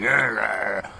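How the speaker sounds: low, rough smoker rasp; like a thirty-year smoker; fake smoker's voice. Shown as a demonstration. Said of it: "not putting on a fake, like, phony".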